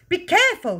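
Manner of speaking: high, loud warning shriek. A narrator's reading. Mother sees her powder spilling, shrieking. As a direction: shriek it in alarm. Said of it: "shrieked the mother".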